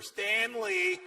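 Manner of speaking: very high, drawn-out wifely call; high sing-out of the name; Missus Roper. Acted. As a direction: call out shrilly for a husband. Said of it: "I pictured Missus Roper".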